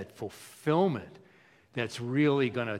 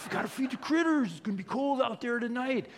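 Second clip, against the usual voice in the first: high-pitched folksy impersonation; playful high-voiced imitation; Dick Goddard; 10 semitones higher than usual. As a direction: put on a much higher voice, reminding folks kindly. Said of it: "Dick Goddard would remind them. Remember him?".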